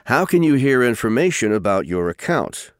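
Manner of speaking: normal talking voice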